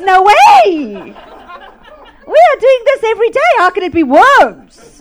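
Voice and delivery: loud, high, indignant disbelief; disgusted outburst. Retold or imitated. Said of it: "he was so disgusted with this man".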